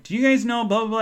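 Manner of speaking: high-pitched quoted question; eager retold question. Traveler asking strangers about a colleague, eagerly in a high voice. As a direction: ask it high, imitating yourself. Retells asking people at the airport.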